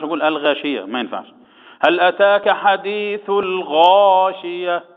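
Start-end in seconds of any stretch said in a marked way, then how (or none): 1.8-4.8 slow chanted verse with a heavy غا; recited demo. Shown as a demonstration. Sheikh demonstrates the verse to the class, slow chant. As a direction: model the verse slowly, leaning on the heavy غا. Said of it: "يبقى هنا يا إخواني يقول".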